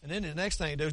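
ordinary delivery

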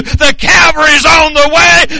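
loud, high shouted preaching; preaching at full force. Acted. Preacher rallying the congregation, shouting. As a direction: build to a rousing climax.

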